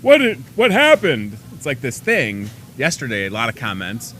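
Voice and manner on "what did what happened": mock viewer shock. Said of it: "people are like".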